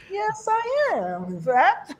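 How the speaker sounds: very high, slow, drawn-out reply; high-pitched answer. Retold or imitated. Visitor answering excited British kid, high drawn-out voice. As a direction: draw it out high in mock grandness.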